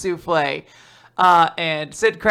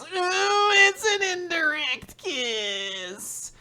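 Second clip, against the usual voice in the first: flustered squeal; 12 semitones higher, 5 dB quieter, much slower than usual. Acted. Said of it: "And Sid goes".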